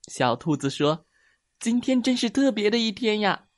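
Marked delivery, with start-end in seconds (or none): 1.6-3.5 very high rabbit voice; happy little rabbit. Acted. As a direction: exclaim with childlike delight. Happy rabbit after a game. (小兔子开心地尖声感叹)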